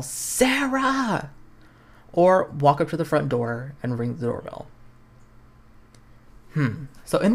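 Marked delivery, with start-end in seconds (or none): 0.0-1.3 high, drawn-out yelled name. Acted. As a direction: call her name out from the car. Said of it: "Hold down the horn and yell".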